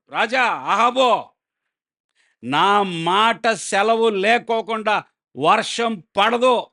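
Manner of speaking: prophet's proclamation. Acted. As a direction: call it out slowly to the king as a bold prophet. Elijah confronts King Ahab.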